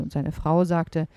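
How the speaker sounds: ordinary delivery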